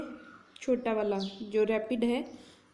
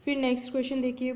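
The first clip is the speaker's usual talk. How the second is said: ordinary talk, nothing marked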